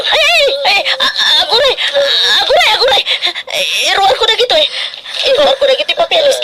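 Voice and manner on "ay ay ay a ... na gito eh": high, crying pleas to wait; panicked cry. Acted. Daughter crying out in panic at her dying mother. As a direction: beg in alarm as she collapses.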